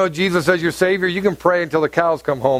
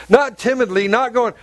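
normal talking voice

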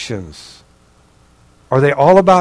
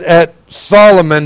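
normal talking voice

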